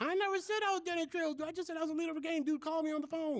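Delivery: high-pitched mock self-defence; indignant protest. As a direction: protest your innocence in a high voice. Retold protest to angry AD.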